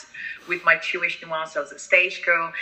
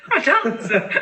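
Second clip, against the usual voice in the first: laughing repeat; 6 semitones higher, 4 dB louder than usual. Real, not acted.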